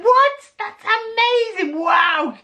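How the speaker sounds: high, loud, squeaky what; mock astonishment. Narrator hamming up shock at the bigger tale. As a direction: gasp it out in exaggerated disbelief. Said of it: "And I was like".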